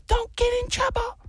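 very high wifely warning; imitated wife's warning. Wife warns husband leaving the cabin, high mock wifely voice. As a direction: mimic the wife in a high, airy voice. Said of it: "she goes".

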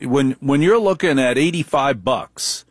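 usual speaking voice

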